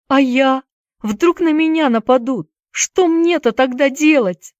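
high, plaintive worried voice; tearful questioning. A narrator's reading. Boy afraid of being attacked, tearful questioning. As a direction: ask anxiously, near tears. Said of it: "глотая слёзы, спросил Уилл".

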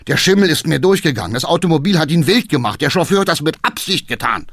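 fast, loud flustered explanation; hurried excuses. Acted. Cabman excusing himself, fast and breathless. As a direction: blurt it all out at once.

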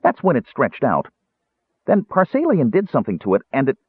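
high-pitched kender explanation; high character voice, chattering on; explaining kender. A narrator's reading.

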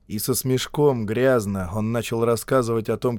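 ordinary delivery

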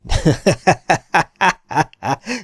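loud stage laugh in rapid bursts; roaring laugh. A narrator's reading. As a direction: burst out in a long peal of laughter. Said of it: "kacagott Miller".

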